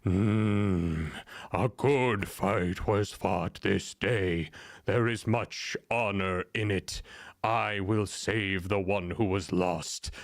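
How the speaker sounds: slow, low rumbling god voice; rumbling Stormfather. Acted. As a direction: speak like a vast storm god, slowly. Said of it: "rumbles down upon the earth".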